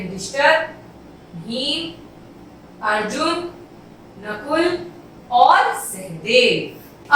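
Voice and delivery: slow, each name followed by a clear pause; pauses between names. Shown as a demonstration. Teacher demonstrating comma pauses to her pupils. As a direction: leave a small gap after every name. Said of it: "एक थोड़ा सा विराम देना है।".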